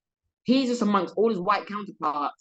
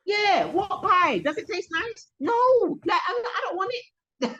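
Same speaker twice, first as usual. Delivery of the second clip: high, drawn-out comic protest; mock incredulous retort; 12 semitones higher, slower than usual. Accused of taking their slice, high mock disbelief. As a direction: scoff at the offer in a high voice.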